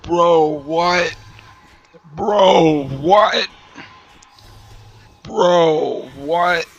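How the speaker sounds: lightly imitated meme clip, climbing in pitch; quoting the meme voice. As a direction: mimic a baffled meme voice, rising. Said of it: "it said".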